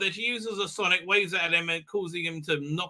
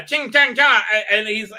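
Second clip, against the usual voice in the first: ordinary talk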